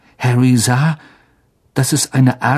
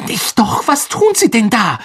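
high, urgent hiss; hissed alarm; 11 semitones higher than usual. A narrator's reading. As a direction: hiss in alarm to stop someone. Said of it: "zischte Harry". (aufgeregt gezischt)

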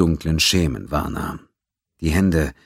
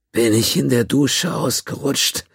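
much higher, strained croak; 9 semitones higher, 3 dB louder than usual. A narrator's reading. Battered Quinn answers Gans, croaking painfully. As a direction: croak it out like a beaten man. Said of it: "krächzte Quinn".

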